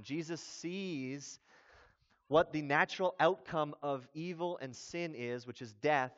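ordinary delivery

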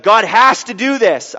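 mock-demanding preacher, emphatic. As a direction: imitate preachers making demands of God. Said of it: "talking like this".

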